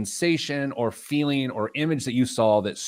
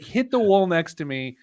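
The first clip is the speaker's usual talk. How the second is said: normal talking voice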